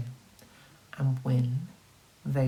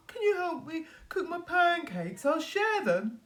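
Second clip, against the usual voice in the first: a lightly voiced wolf, higher and pleading; kindly wolf; 13 semitones higher, 4 dB quieter than usual. The wolf kindly asks the three pigs for help, higher. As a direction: ask sweetly for help in a higher voice. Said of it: "he said kindly".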